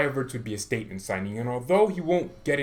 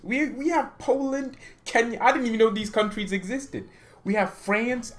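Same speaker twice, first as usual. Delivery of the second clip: amazed; 10 semitones higher than usual. Real, not acted. Amazed reading of far countries.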